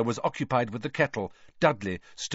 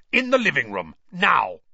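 loud furious command, higher; angry barked order; 4 semitones higher, 7 dB louder than usual. Acted. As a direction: bark the order at him. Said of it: "he barked at Harry".

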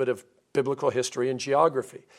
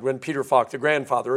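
ordinary delivery

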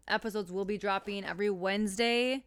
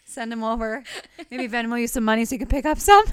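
joking; 3 semitones higher, 14 dB louder than usual. Pretend. Joking demand for flowers.

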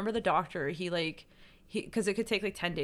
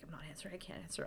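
much softer, barely there; choked murmur; 15 dB quieter, faster than usual. Retold or imitated. Ignoring her mother's call while crying, very soft. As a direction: mutter through tears.

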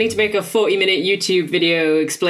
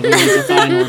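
anime voice; 5 dB louder than usual. Pretend. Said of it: "you know, anime voice".

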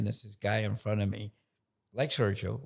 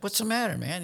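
barber voice; 9 semitones higher than usual. Retold or imitated. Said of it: "And the barber says".

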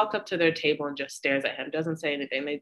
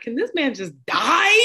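exasperated; 6 semitones higher, 6 dB louder than usual. Retold or imitated. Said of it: "I'm like".

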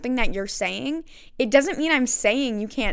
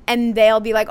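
ordinary delivery

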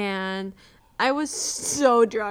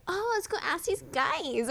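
high-pitched airy mimicry; mock clueless girls, pitched up; naive friends; 7 semitones higher than usual.

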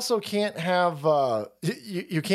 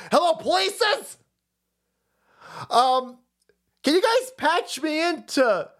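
put-on higher, whiny old man begging on the phone; mock Paul Pelosi; 8 semitones higher than usual. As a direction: plead like a confused old caller, pitched up. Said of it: "this is Paul Pelosi".

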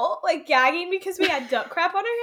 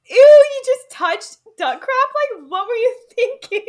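disgusted teasing; 9 semitones higher, 11 dB louder than usual. Retold or imitated. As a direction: tease him, half grossed out, half laughing. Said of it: "I was like".

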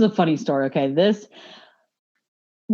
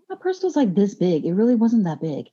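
ordinary talk

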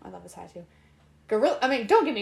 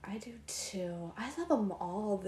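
whispering; 3 semitones lower, 12 dB quieter than usual. Real, not acted. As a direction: whisper it back. Whispered reply.

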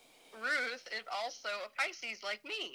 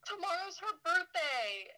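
high-pitched, delighted discovery; excited surprise; 8 semitones higher than usual. Real, not acted. Telling co-host of a birthday coincidence, excited surprise. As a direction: blurt it out high, in real surprise.

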